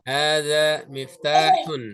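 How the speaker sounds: Hadza baitun drawn out slowly, syllable by syllable; a teacher modelling Arabic for students to repeat; slow model pronunciation. Shown as a demonstration. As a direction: say Hadza baitun slowly so the class can copy. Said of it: "Ikuti saya".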